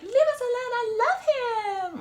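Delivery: high, loud, pleading lovestruck voice; hammed-up swooning lover; mock lovesick woman. Mocking a smitten client begging to be left alone, high and loud. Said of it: "being like".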